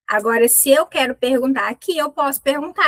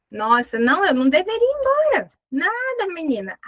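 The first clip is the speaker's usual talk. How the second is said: playful scoff; 6 semitones higher than usual. Acted. Playful chiding aside.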